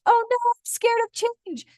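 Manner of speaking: high, put-on fearful voice; mock scared whine. Mocking the listener's fear.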